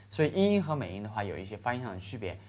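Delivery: usual speaking voice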